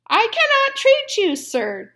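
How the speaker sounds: high, loud shout of refusal. A narrator's reading. Mouse dentist shouting down at the fox. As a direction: call it down sharply through the window. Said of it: "Doctor DeSoto shouted".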